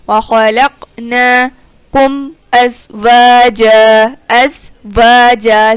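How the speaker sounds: ازواجا with a hissing, whistling ز; whistled zay. Shown as a demonstration. Teacher demonstrating the whistling sound of zay. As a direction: let the ز in ازواجا whistle, slow chant. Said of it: "زا میں ہم سیٹی کی آواز".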